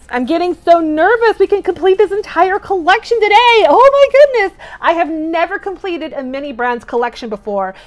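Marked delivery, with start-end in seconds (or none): 0.1-4.6 high, loud, rising agitation; giddy, worked-up outburst; nervous excitement. Real, not acted. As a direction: build to a squeal of anticipation.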